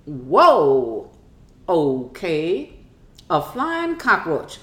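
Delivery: loud drawn-out "whoa", then slow realization; stunned mom taking it in; mock astonishment. Mom's stunned reaction, acted out.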